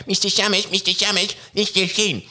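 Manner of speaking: high squeaky puppet voice; taunting puppet voice. Retold or imitated. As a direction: play the cheeky little puppet, pitched high. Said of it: "would come up on stage and go".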